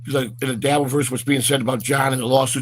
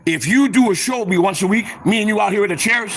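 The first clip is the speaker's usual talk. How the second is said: excited; 6 semitones higher than usual. Real, not acted. Pitching Pops a deal, excited.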